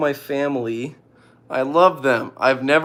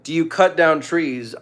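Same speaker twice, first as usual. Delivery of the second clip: ordinary talk, nothing marked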